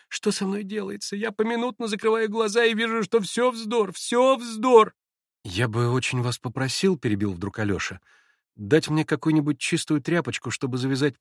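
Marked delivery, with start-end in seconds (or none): 0.1-4.9 высоким голосом, на грани истерики; взвинченно твердить, что всё вздор; истеричная дама. Acted. Хохлакова в истерике. (истерично, высоко и нараспев)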